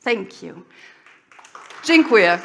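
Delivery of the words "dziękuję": "Dziękuję" tried loudly as "jin-kwee-yeh"; careful foreign attempt. Real, not acted. Speaker trying Polish thanks on the audience, "Dziękuję" loud as "jin-kwee-yeh". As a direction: say "Dziękuję" loud, with a non-native accent. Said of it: "Was that pronounced correctly".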